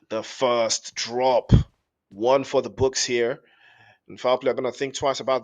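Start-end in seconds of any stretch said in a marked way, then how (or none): 0.1-1.5 drawn-out emphasis. Real, not acted. Caster echoing the first kill.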